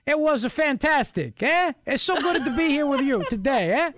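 a cartoonish Italian priest accent, stretched out; Guido Sarducci Italian accent. Host mocking the Italian prime minister in a hammed-up Italian accent. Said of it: "hoping that he would sound like Guido Sarducci".

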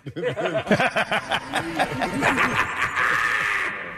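long villainous cackle; evil cartoon laugh. Real, not acted. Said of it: "It sounds like a cartoon evil laugh".

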